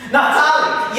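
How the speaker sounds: high, loud, ringing demon voice; booming Satan. Retold or imitated. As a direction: boom out a grand devilish greeting. Satan calls the name.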